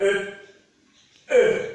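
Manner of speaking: "E" said as the French sound. Shown as a demonstration. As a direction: model the vowel "E" clearly. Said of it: "this one is pronounced like".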